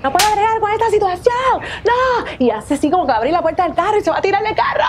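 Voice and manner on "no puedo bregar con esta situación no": high, rising hysterical whine; working herself up in the car; shrill jealous meltdown, pitch climbing; hysterical girlfriend. Acted.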